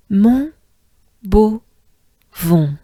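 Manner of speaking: "Mon" "beau" "vent" split, nasal "vent"; syllable by syllable. Shown as a demonstration. Teacher spelling out Montbeauvon syllable by syllable. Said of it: "Good breath".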